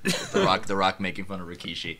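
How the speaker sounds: ordinary delivery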